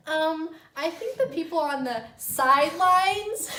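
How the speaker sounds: high, loud, drawn-out mock suggestion; loud, slow, mocking schoolgirl-style voice; hand-raising classroom pupil. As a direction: imitate an eager pupil piping up in class. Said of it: "like she was in fucking class".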